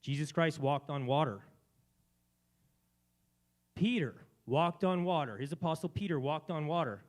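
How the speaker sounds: loud, high, fervent preaching; emotional preaching. Real, not acted. Preacher telling the congregation about Peter, fervently loud. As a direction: swept up in the story, pressing hard. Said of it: "I got a little emotional there".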